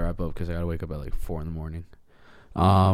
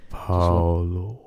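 hypnotic Paolo. Retold or imitated. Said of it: "hypnotizing him with his voice".

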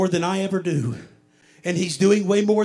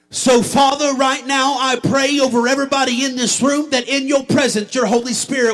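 loud, urgent pastoral prayer in a driving stream; fervent prayer; 5 semitones higher, 7 dB louder than usual. Acted. Pastor asking God to strengthen the congregation, fervent prayer. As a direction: pray at full force over the room.

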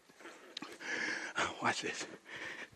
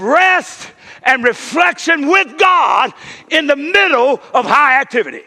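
high, ringing sermon voice; full-force preaching; 11 semitones higher, 10 dB louder than usual. Acted. Pastor to his church, full-force preaching. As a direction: preach it to the rafters.

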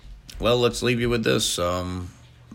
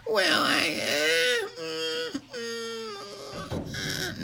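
very high, strained hemming; comic high-pitched reluctance; squirming hesitation; much slower than usual. Acted. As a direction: stall with drawn-out high whines and hums. [name] hesitates at host's question.

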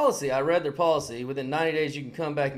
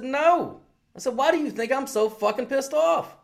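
higher and louder, indignant; retold angry retort; 10 semitones higher, 4 dB louder than usual. Snapping back at the regional manager, angry. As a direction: throw it back at him like it's obvious.

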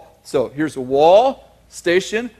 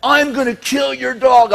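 raised, higher angry threat; mock yelling threat; 6 semitones higher than usual. Neighbour yelling a threat down the phone, raised and higher. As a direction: bark the threat like the furious neighbour. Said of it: "he would call me yelling".

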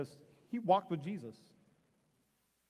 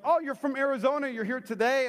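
higher, upbeat imitated hello; eager greeting; 7 semitones higher, 4 dB louder than usual. Preacher meets man from Arizona, higher eager greeting. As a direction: greet a new acquaintance brightly.

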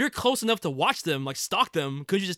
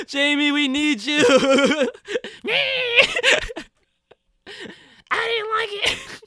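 high, drawn-out fake wail; hammed-up sobbing plea, breaking into laughs; mock crying; 12 semitones higher, 5 dB louder, much slower than usual. Co-host jokingly lamenting the show, high mock crying.

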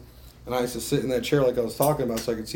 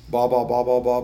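louder, tossed-off blah blah; dismissive blah; 5 dB louder than usual. Real, not acted. Waving off the guys' talk, dismissive. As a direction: brush it off with a blah blah. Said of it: "dismissive filler".

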